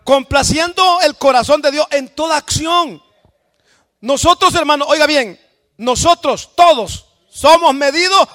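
loud, fervent shouted sermon with rising cries; full-force preaching. Acted. Pastor rousing the congregation to praise God, full-force preaching. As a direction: preach it out to the whole church at full voice.

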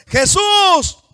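high, very loud drawn-out cry; full-lung shout. Acted. Blind man cries out to Jesus over the crowd, full-lung shout. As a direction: fill your lungs and holler for mercy. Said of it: "mejor agarró aire con sus pulmones".

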